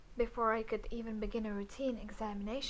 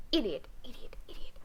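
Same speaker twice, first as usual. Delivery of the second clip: scolding whisper; faster than usual. Acted. Whispered insults.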